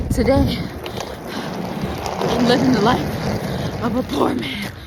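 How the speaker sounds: loud. Real, not acted. Loud jokey announcement outdoors.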